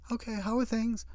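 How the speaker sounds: a hint of a cheerful sister-in-law; lightly voiced Susan. Susan answers phone, voiced.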